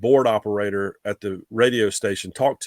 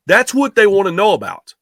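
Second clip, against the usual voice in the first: insistent boss; 8 semitones higher, 5 dB louder than usual. Retold or imitated. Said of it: "He goes".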